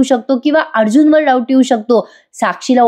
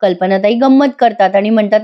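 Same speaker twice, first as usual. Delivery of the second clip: ordinary talk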